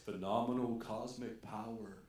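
low, booming genie voice; big booming voice. Retold or imitated. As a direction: boom it out like a genie. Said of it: "says in a big booming voice".

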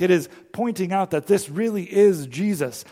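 normal talking voice

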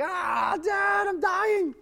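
high, drawn-out mock wail; hammed-up screaming little kid; screaming hurt child. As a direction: wail like a kid who thinks he's dying. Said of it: "they would come to us, like, screaming, crying".

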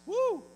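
loud, high "woo"; joyful whoop. Real, not acted. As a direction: let out a high preacher's whoop. Preacher whoops at being forgiven.

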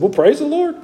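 high, bright burst of praise; a preacher thrilled at the news; delighted preacher. Retold or imitated. Preacher to the boy who just got saved, high and glad.

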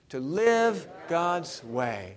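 very slow, word by word; slow for joining in. Shown as a demonstration. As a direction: lead the congregation slowly through each word. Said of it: "say those three words with me".

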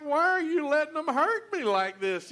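high, loud pleading wail; imitated crying child. Grandson pleading with grandpa during x-rays, imitated crying. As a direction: plead like a hurt little boy. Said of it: "he's just crying his eyes out".

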